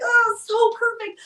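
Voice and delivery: high, slow, near-tearful forced delight; mock-thrilled, voice wobbling on the edge of tears; strained fake cheer. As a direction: squeeze out brave excitement through gritted nerves. Forced cheer over wet puppy.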